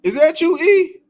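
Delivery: high and loud, excited; excited call. Real, not acted. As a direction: call out in happy surprise. Recognizing a viewer, calling out.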